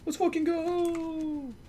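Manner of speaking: high, slow, drawn-out victory cry; high, slow celebratory chant; hype cheer. Real, not acted. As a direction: stretch it out in triumph, high. Celebrating again.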